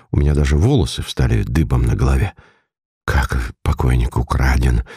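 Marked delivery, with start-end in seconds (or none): 3.0-4.8 low, understated stammer of disbelief; stunned babble. A narrator's reading. Narrator to detective on hearing the corpse is stolen, dumbfounded low babble. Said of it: "пролепетал я".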